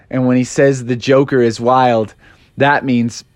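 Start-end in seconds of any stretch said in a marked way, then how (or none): none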